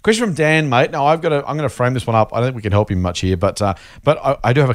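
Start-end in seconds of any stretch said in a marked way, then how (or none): none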